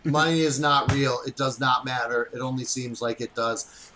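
low, quiet mock-profound. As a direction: sound deep and wise, half-joking. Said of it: "trying to sound profound".

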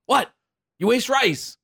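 high, sharp mock grandma scolding. Grandma scolding a kid for wasting rice, mock outrage. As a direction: play the outraged grandma over wasted rice.

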